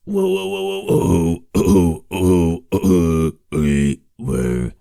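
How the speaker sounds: loud, deep throat bass growl. Shown as a demonstration. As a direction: rumble it from the throat. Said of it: "clear your throat from that noise".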